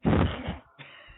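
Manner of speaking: breathy, loud sinister snort-laugh; a creepy villain cackle; evil villain laugh. Pretend. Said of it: "That's, that's your evil villain laugh".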